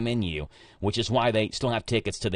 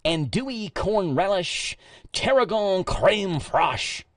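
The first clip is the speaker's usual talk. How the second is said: put-on higher, slow joking Danville accent on the menu; radio host clowning a fancy menu in a Danville accent; mock Danville accent; 7 semitones higher, slower than usual. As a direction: read the French menu in a hammed-up Danville accent. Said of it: "I really like the accent".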